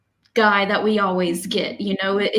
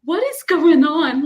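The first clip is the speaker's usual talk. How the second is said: pitched-up mock disbelief; half-joking what is going on; baffled; 6 semitones higher than usual. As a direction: throw up your hands in confusion. And I'm like.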